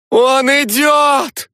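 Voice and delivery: very high, slow drawn shriek. A narrator's reading. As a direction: shriek a warning from outside. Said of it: "завизжала с улицы Свана".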